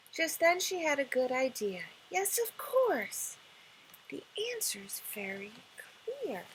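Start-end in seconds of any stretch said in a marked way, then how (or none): 2.1-3.4 high, slow, delighted; delighted realization. Acted. Princess gets an idea. 4.1-6.5 secret whisper. Acted. Princess confides her idea.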